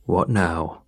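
low, irritated grumble; a hint of a groggy landlord. A narrator's reading. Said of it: "groggy, irritated".

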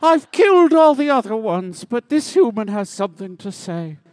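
booming high villain boast; loud villain boast. Acted. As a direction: gloat it out at full volume.

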